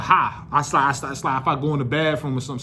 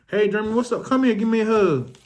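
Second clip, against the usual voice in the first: high, cheery mom voice; mom greeting; 9 semitones higher than usual. Retold or imitated. Moms asking Jeremy for a hug, high cheery voice. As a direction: imitate the moms, much higher and sweet.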